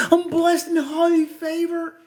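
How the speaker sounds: high put-on sanctimonious voice; fake church folks. Fake church folks greeting others, high sanctimonious voice. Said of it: "you know they fake".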